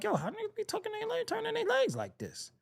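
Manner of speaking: high, fast, hushed disbelief; baffled high voice. Retold or imitated. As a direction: squeak it out in quick confusion. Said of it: "but I was like".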